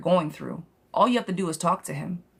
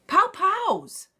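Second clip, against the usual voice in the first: squeaky much higher pow pows; playful pow pows; 12 semitones higher than usual. Real, not acted. Psychic cheering on a widowed client, squeaky playful pows. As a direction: throw two mock punches with your voice. Playful punch sound after pep talk.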